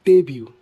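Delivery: debut as DAY-byoo, falling; silent-T debut. Shown as a demonstration. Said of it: "where the T is silent".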